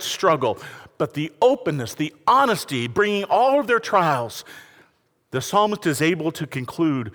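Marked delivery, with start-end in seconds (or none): none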